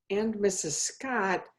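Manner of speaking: usual speaking voice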